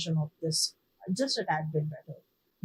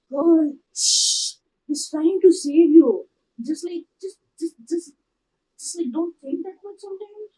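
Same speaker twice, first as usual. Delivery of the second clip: high, loud pleading hush; begging a character to stop talking; exasperated fan; 9 semitones higher, 10 dB louder than usual. Retold or imitated. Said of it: "I went".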